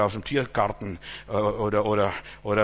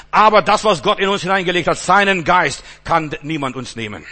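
defiant preaching; 3 semitones higher, 6 dB louder than usual. Acted. Defiant declaration to enemies.

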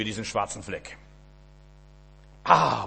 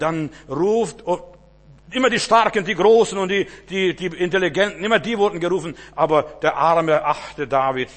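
higher, louder, full-force sermon voice; fervent preaching; 5 semitones higher, 7 dB louder than usual. Acted. Preacher telling David's story, loud fervent preaching. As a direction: preach it out with fire.

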